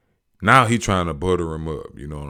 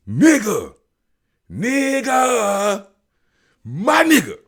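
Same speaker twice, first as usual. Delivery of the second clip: much higher and louder, mocking a clueless fan; hammed-up joke impression; 8 dB louder, slower than usual. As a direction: ham up the fan's bad impression. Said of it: "he acting the joke out".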